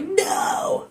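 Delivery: dismayed cry. Retold or imitated. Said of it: "I was like".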